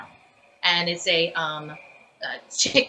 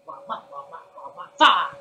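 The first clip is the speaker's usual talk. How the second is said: creature syllables; 3 semitones lower, 6 dB louder than usual. Shown as a demonstration. Actor demos a third syllable pattern, ending on a loud fa. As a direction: trail off then pop the last syllable. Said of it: "or I can do".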